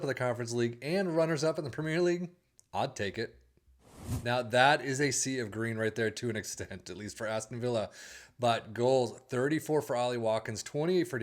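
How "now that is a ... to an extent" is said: ordinary talk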